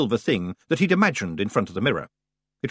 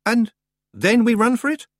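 hopeful; 12 semitones higher, 6 dB louder than usual. Acted.

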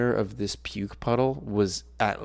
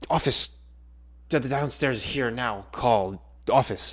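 breathless panic; 2 semitones higher, 4 dB louder than usual. Retold or imitated. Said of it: "completely out of breath".

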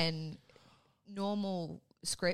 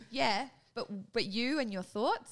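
imitating the teacher; 6 semitones higher, 4 dB louder, slower than usual. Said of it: "He goes".

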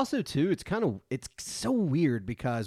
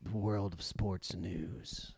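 creepy sultry voice. Acted. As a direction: purr it like a creepy radio host. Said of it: "that rapey voice".

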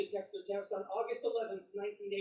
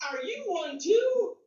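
high, slow, hopeful and louder; eager question; 8 semitones higher, 7 dB louder, slower than usual. Retold or imitated. Southern soldier hopes a new friend shares his faith, high eager ask. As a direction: ask it like you can't wait to hear yes.

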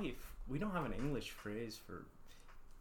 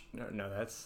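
ordinary talk

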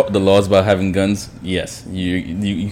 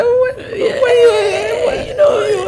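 high, drawn-out mock Haitian accent; exaggerated Haitian accent; much slower than usual. Shown as a demonstration. Showing friends how Haitians elongate words, wailing Haitian accent. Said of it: "they Haitians, they, like, elongation".

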